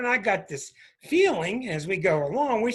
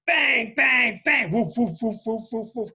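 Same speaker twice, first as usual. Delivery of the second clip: imitated bangs and barks; 4 semitones higher, 4 dB louder than usual. Imitating banging and barking dog.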